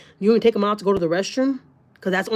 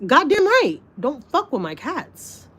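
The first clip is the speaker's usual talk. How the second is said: high outburst, then slow warning; indignant, drawn-out warning; heated retort; 7 semitones higher, slower than usual. Retold or imitated. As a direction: snap back high, then warn slowly. Acted retort in retold quarrel.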